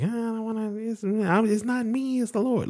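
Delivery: high falsetto mock modesty; high put-on voice of false modesty; mock humble voice. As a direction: squeak it out like a humble saint. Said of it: "'cause I'm like".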